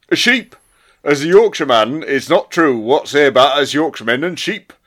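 the same words again, now in broad Yorkshire; Yorkshire accent. Shown as a demonstration. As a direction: redo the line as a Yorkshireman. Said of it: "I'm gonna do this in Yorkshire".

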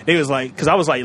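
ordinary delivery

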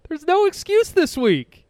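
high, loud and emphatic; fired up. Real, not acted. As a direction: punch the point home, excited.

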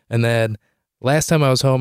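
ordinary talk, nothing marked

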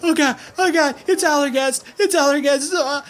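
high, loud, terrified cries; mother wailing in panic about a monster; panicked wailing. Acted. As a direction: gasp out the name in terror. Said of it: "bawling her ears out".

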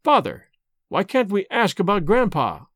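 ordinary delivery, nothing marked

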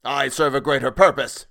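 high, forceful outcry; shouted. A narrator's reading. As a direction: shout it with fanatical conviction. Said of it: "shouted Selwood".

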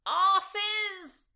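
high, loud insulting shout; yell. A narrator's reading. Drunken Oxford as Constance falls off him, yelling. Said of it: "yelled Oxford".

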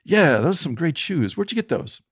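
high, friendly mock street patter; panhandler's voice. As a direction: imitate a stranger buttering up a tourist. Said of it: "And he goes".

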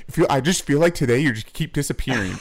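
half-laughing imitation of her gripe; laughing mock complaint. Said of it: "And she's like".

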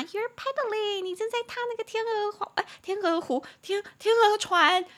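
very high, sing-out cutesy voice. Pretend. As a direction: play it sweet and cute, way up high. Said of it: "听起来是一个很可爱的字吧".

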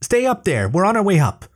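high call to the top of the ladder; yelling up. A narrator's reading. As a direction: shout it up so the otter hears. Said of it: "she yelled back".

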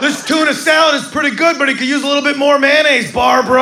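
high, loud prissy complaint. Retold or imitated. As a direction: play the fussy dad at the lunch table.

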